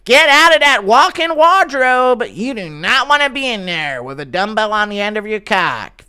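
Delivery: hammed-up commentator hollering a warning; Rogan yelling. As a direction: stretch it out in a high yell. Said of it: "yelling at Connor to get out of there".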